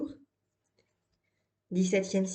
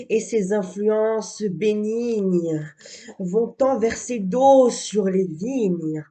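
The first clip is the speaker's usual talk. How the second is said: declaimed verse, fuller and louder than talk; poem recitation; 7 dB louder than usual. Acted. Reciting Saint-Amand's poem on the welcome rain, declamatory verse reading.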